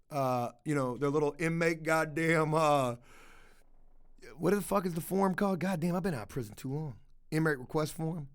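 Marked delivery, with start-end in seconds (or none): none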